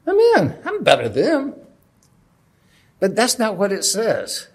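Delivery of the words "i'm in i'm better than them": much higher, louder smug gloating; mock self-righteous bragging; smug boast. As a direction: crow it, pleased with yourself. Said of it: "You ever thought that when you read this".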